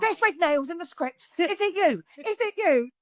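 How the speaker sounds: imitating a friend's excited, high-pitched phone call; high, urgent questioning as retold excitement. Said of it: "she was so excited".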